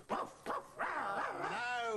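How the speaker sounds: imitated woofs and growls; dog barking. Puppeteer playing the dog in the show, barking.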